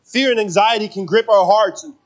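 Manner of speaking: heartfelt sermon delivery; preaching. Acted. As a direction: lean into each line from the pulpit. Preaching to congregation.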